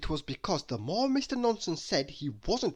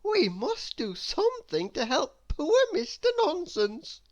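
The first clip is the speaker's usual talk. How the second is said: put-on upset little girl; 13 semitones higher than usual. Miss Dotty continues speaking.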